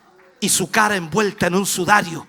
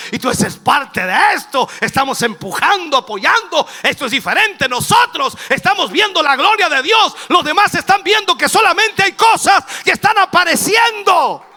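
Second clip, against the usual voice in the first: high, shouted sermon climax; fervent pulpit shout; full-force preaching; 9 semitones higher, 10 dB louder than usual. Real, not acted. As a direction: preach it loud, rising to a peak.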